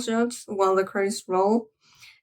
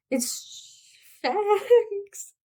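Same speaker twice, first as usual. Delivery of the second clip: choked up; 10 semitones higher than usual. Real, not acted. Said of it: "it's making me emotional".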